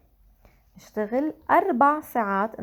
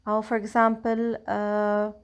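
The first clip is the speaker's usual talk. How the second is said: usual speaking voice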